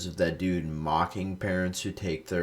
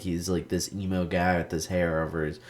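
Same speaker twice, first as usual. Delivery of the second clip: usual speaking voice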